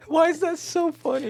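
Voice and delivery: squealing through laughter; laughing. Real, not acted. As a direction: ask it while cracking up. Laughing question.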